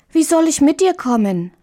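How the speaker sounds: put-on a small girl's anxious question; little girl Marie. Marie speaks in the tale. (kleine Marie, ängstlich fragend)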